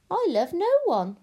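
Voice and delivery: high, loud, haughty declaration; proud princess. A narrator's reading. Princess refuses the witch's demand, proud. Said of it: "said the princess proudly".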